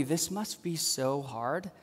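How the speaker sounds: quiet mock inner voice; inner thought. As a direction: murmur it to yourself with sympathy. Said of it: "they're thinking".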